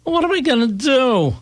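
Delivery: high, pleading moan; whining husband.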